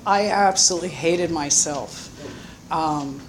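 ordinary delivery

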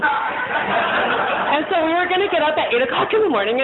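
real laugh breaking through while telling it. Real, not acted. Laughing while retelling the plan.

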